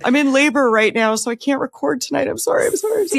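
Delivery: hammed-up panic; mock frantic apology. Host hamming a frantic apology while in labor.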